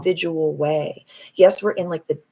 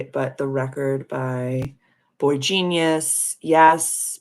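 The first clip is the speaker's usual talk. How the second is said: normal talking voice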